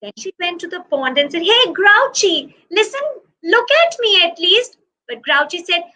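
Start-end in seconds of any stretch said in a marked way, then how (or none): 1.4-4.8 high, bright-eyed little lamb calling out, slowed on the name; a high childlike voice pleading for attention; Fluffy the lamb. Acted. Lamb begging her friend Grouchy to look at her, in a high childlike voice.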